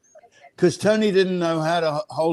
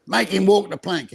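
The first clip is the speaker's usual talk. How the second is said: Cockney pirate shout; 6 semitones higher than usual. Retold or imitated. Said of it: "do all the Cockney accents".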